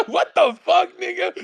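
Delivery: high, laughing what the fuck; laughing disbelief. Real, not acted. Disbelief at own mock quote.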